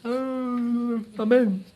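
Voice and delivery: mock yawn sliding into a drowsy amen; yawning prayer. Pastor mimics believers praying half asleep, yawning. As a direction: pray through a put-on yawn. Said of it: "oramos como con bostezos".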